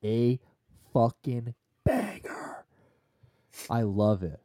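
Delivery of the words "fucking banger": whisper. Pretend. Whispered praise.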